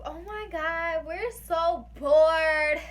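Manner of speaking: loud drawn-out whine of boredom; exaggerated bored. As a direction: moan it, hammed-up bored. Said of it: "we were like".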